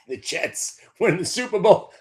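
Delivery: laughing through the words; can't get it out without cracking up. Real, not acted. Fan laughing at his own team's Super Bowl hopes, laughing. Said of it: "I can't even say that out loud without laughing".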